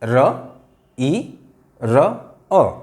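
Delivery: र, ई, र, अ said one by one; sounds broken apart. Shown as a demonstration. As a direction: split रिर into its four sounds slowly. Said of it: "बल्कि चार कहिए". (रिर की चारों ध्वनियाँ अलग-अलग बोलें)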